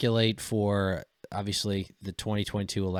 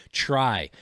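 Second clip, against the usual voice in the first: usual speaking voice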